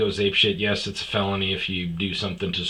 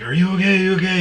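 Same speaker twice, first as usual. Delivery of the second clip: higher, louder urgent questions; alarmed retold check; 9 semitones higher, 6 dB louder, much faster than usual. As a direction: check on them in mock alarm. Retold check on fallen buddy.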